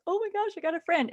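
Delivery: small excited squeak of wonder; imitated childlike delight. Lonely boy gains first friend, high delighted voice.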